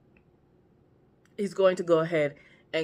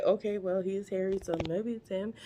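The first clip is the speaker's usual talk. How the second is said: softer, private musing; thinking to himself; 7 dB quieter than usual. Retold or imitated. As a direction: voice his inner reasoning under your breath. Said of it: "He's thinking in his head".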